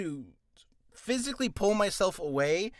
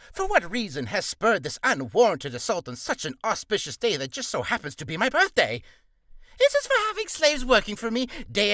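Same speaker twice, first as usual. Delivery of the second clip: pompous tyrant king, higher and louder, rising to outraged yells; a blustering, self-important villain king ranting; King Flabbergast; 5 semitones higher, 5 dB louder than usual. Acted. King confronting Max Dougal at his birthday, blustering loudly. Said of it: "let us do King Flabbergast".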